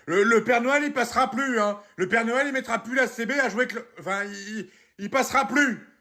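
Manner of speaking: high-pitched, stammering rant; angry threat. Acted. Parent sick of Christmas mess, ranting angrily. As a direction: lose your temper while laying down threats.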